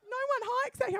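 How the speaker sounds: a rowdy bogan who can't believe it; incredulous bogan. Retold or imitated. Bogans incredulous at lone hiker.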